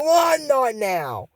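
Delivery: slow, high, put-out reply; muddy princess sulks at her friend, high and slow; sulky retort. Acted. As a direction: drag it out like a grumpy princess. Muddy Amber sulking reply.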